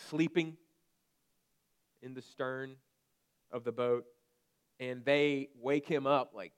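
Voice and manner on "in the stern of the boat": ordinary talk, nothing marked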